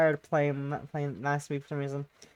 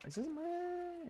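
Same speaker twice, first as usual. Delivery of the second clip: high, slow, puzzled; surprised question; 14 semitones higher, 12 dB quieter, much slower than usual. Real, not acted. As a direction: rise up in surprise.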